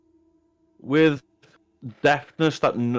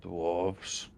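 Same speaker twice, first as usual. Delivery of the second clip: low, quiet, brooding repeat of "Dwarves"; grim quiet word; 4 semitones lower, 11 dB quieter than usual. Acted. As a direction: say "Dwarves." quietly, brow furrowed, barely above a mumble. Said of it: "his brow furrows and he goes".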